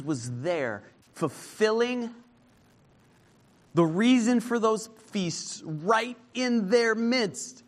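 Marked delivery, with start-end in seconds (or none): 1.1-7.6 higher, slow and weighty; emphatic preaching. Acted. Preacher stressing Jesus among the feasts, slow emphatic preaching. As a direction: lean into each word, slowly.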